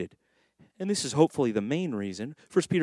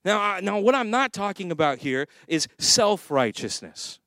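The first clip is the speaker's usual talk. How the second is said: ordinary delivery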